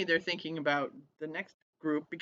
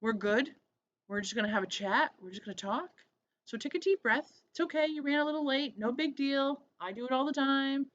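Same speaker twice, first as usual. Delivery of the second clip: higher, gently reassuring the nervous interviewee; calm her down like a kind host; 8 semitones higher than usual. Retold or imitated. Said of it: "I just stopped and said".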